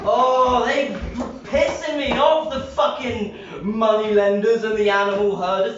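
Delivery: high, loud angry rant; raging about the temple moneylenders; furious rant. Real, not acted. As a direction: vent the grudge at full volume.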